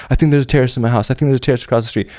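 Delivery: fast, rattled-off reports; panicked rush. Retold or imitated. Residents urging the soldier, in a rapid panic. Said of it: "Someone tells you".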